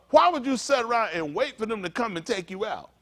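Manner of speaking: ordinary talk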